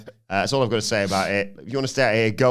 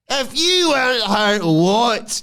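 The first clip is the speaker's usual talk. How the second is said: much higher, slow sneering taunt; high mocking Fatu taunt; 13 semitones higher, 6 dB louder, much slower than usual. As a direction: taunt him in a silly high voice.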